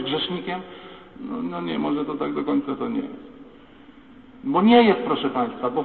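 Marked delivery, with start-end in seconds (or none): 4.4-5.0 emphatic denial. Real, not acted. Emphatic rebuttal.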